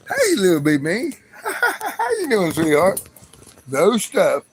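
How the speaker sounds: loud, high and delighted, laughing through it; excited greeting. Real, not acted. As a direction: greet them like a long-lost friend.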